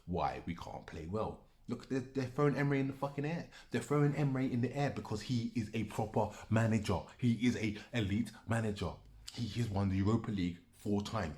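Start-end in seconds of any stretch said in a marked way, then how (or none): 1.7-11.4 heated praise. Real, not acted. Praising the rival manager heatedly.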